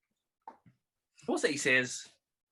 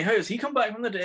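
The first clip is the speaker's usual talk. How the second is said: mock shock; 10 semitones higher, 4 dB louder than usual. Acting Homer's shock.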